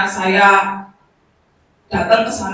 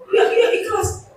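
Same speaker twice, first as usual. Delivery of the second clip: grandmother's voice; 11 semitones higher than usual. Retold or imitated. Grandmother agreeing, voiced higher.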